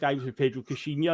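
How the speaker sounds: ordinary talk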